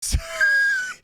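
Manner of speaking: high shriek of laughter. Real, not acted. Reviewer cracking up at the plot, shrieking laugh.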